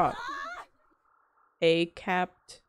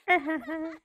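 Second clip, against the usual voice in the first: nervous laugh; 9 semitones higher than usual. Real, not acted.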